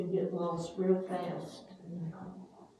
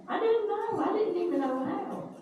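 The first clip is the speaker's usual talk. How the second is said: high, incredulous; marvelling at the discovery; amazed; 12 semitones higher than usual. Real, not acted. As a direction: still reeling, voice up high. Still amazed after laughing.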